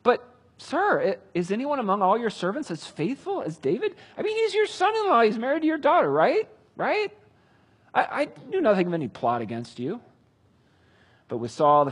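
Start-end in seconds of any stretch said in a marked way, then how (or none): 0.0-10.0 high, anxious, rising plea; frightened pleading. Acted. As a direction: beg the king to see reason. Priest pleads with angry king.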